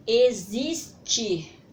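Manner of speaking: teacher loudly sounding out "Existir" for the class; sounding out the word. Shown as a demonstration. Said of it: "através da pronúncia da palavra".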